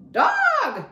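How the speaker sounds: very high, loud squawk; parrot scream. A narrator's reading. Parrot spotting the dog coming into the shop. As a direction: screech it like an alarmed parrot. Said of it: "screamed Gertrude".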